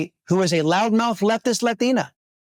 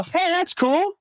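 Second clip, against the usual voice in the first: much higher, breezy mock approval; mock careless voters; 12 semitones higher than usual. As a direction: toss it off like voters cheering on something outrageous. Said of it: "Are they just gonna go".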